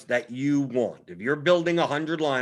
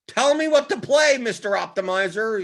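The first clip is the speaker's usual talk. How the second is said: high, loud plea to a wizard; mock pleading user; 8 semitones higher, 6 dB louder than usual. Naive player begging the optimizer for lineups, mock high plea. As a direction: beg the machine for answers, hammed up.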